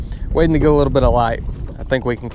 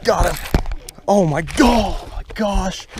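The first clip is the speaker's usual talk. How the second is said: excited; 5 semitones higher, slower than usual. Real, not acted. Big fish hits the glide bait.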